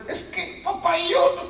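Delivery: put-on high, half-whispered child voice; timid child protest. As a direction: protest weakly like a little boy. Son tries to protest. (niño protestando tímido, voz aguda)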